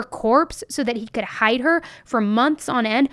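heated, emphatic condemnation; indignant, building a damning list; real outrage. Real, not acted. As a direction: let the anger push the voice up a little. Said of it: "it just infuriates me and enrages me".

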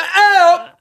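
mock cry for help.

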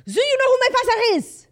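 put-on very high, loud French-accented tantrum; hammed-up French accent, laughing through it; mock French accent. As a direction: imitate an entitled Frenchman's loud outburst. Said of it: "He's French".

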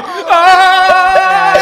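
long, high, loud comic moan-scream; hammed-up orgasmic "aaah"; mock climax scream. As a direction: wail it out in exaggerated ecstasy. Mock climax cry after "You're like.

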